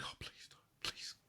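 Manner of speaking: normal talking voice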